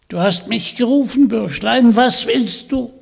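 put-on high, cackling old witch taunting the boy. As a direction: sneer it down at him like a hag. Hexe spricht zu Vanya. (hohe, höhnische Hexenstimme)

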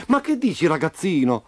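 high, incredulous start, falling away; baffled question. A narrator's reading. Roby's father not understanding Memo, baffled. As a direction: question him in disbelief.

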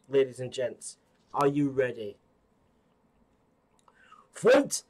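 light imitation of an arcade announcer; booming fighting game announcer. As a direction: call the round start, building to a shout. Mimics the game's fight announcer.

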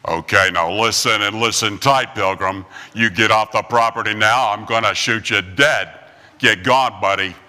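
low, drawling tough-guy voice; John Wayne. Retold or imitated. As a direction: growl it like a cowboy warning a pilgrim. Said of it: "John Wayne says".

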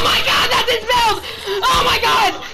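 high, loud wailing outburst; distraught shouting. Real, not acted. Gamer appalled by enemy's build, high distraught shouting.

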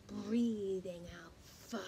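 plain reading voice

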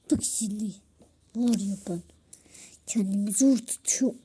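low, breathy threat held in check; held-back angry whisper. Pretend. Big sister warning little sister, restrained whisper. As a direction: hiss it, barely containing anger. Older sister suppressing anger.

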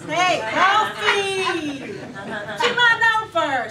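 very slow, high, loud party shout; drawn-out laughing party call; raccoon calling friends. Retold or imitated. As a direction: bellow it like a happy raccoon inviting pals. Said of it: "on his cell phone calling his friends".

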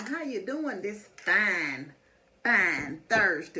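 stretched out, slow and friendly; slow drawl. Real, not acted. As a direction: drag each word out warmly. Drawn-out friendly greeting.

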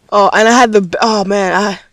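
ordinary talk, nothing marked

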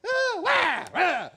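loud, very high mock ranting squawk; hammed-up loud nagging gibberish. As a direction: parody an upset wife's yelling. Wife ranting, mocked by husband.

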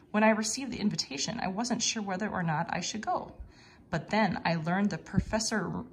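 ordinary delivery

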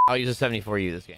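usual speaking voice

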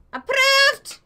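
high, loud proclamation; shouted approval. Pretend. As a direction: yell the verdict with a flourish. Repeats it as a shout.

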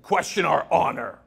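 mock outraged protest; mock indignation. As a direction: play the offended press with hammed-up indignation. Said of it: "they get really angry".

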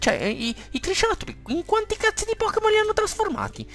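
very high falsetto disbelief; mock-scandalized squeal; shrieking exasperation in falsetto; squeaky outrage. Real, not acted. Outraged at game design.

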